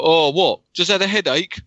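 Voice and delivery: high, loud scoffing question. Retold or imitated. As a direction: belittle their illness. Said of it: "it's just like".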